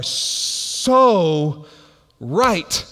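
SO stretched long, then RIGHT set apart; hugely stretched "so", separate "right"; emphatic stress. Real, not acted. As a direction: draw out "so", pause, land "right". Punch line of the moral.

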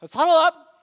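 high, loud rallying call to settle everyone; calming the group. Retold or imitated. Leader rallying anxious students before worship, loud and high. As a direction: call them in like a coach taking charge. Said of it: "And I'm just like".

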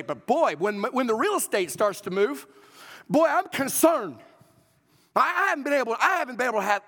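loud, high, worked-up preacher playing a fretting disciple; hammed-up alarm, building to full volume; mock agitated protest. Disciple protesting to the Lord about the temple, mock agitated. Acts out worried disciple confronting Jesus.

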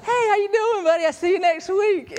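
high, loud, breezy pretend friendliness; fake cheerful greeting. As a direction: act like nothing happened, all smiles. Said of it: "what I wanted to do was like".

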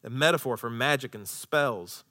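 normal talking voice

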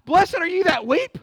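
loud, high, rising in disbelief; incredulous repeat. Real, not acted. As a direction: question the verse as you quote it. Repeats verse in disbelief, then "Cry?.

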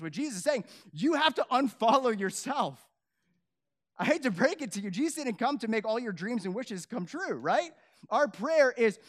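loud, driving sermon build; rising to a shout from the pulpit; fiery preaching. Real, not acted. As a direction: preach it hard to the back row. Fired-up preaching.

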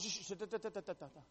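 soft mumbling da da da, like someone muttering while writing; mumbled dictation noises. Retold or imitated. Son imitating his mom repeating words while taking notes, muttered. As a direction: mimic mom muttering as she scribbles it down.

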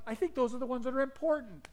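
emphatic retort. Retold or imitated. Preacher's retort to a complaint.

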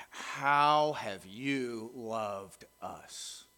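very slow, soft and hurt; doubtful muttered reply. Acted. Exiled people answering God, slow and sulky. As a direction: drag it out, quiet and unconvinced.

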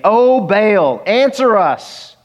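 O Baal stretched long and high; pleading cry. Retold or imitated. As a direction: cry it out slowly and loud, begging a god who won't answer. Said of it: "they prayed out loud, saying".

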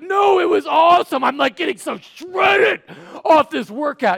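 loud, high, shouted bragging; hammed-up meathead thrill, straining on the big word; overhyped gym guy. Gym guy assuring a worried friend, overhyped.

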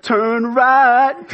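put-on high, loud boy-band croon. Navigation app gives directions, hammed-up boy band voice.

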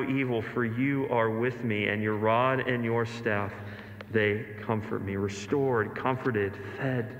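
low, settling into softness; hushed psalm recitation. Acted. Preacher reciting the shepherd psalm to the congregation, low and comforted.

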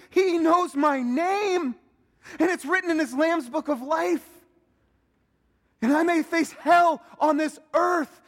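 high, loud and elated; joyful exclamation. Pretend. Pastor voicing a believer's response, loud and elated. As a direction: burst out with the joy of being freed. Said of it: "What does that do to you?".

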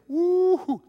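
a high, loud whoop of relief; whooping for joy. Retold or imitated. The preacher recalls thanking God in relief, high joyful whoop. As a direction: cheer it out like a weight just lifted. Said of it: "I'm thinking".

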